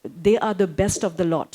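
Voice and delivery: ordinary talk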